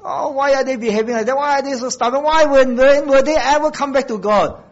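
high, pleading cry of a fretting parent; mock the anxious parent, voice climbing and swelling; worried parent's lament. Preacher imitating a worried parent, pleading and high. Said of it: "Some of you think that".

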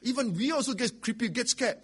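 high, loud mock scolding from the kids; high-pitched, insistent retold plea; protesting children. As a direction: imitate children urgently warning their dad. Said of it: "Then my children will say".

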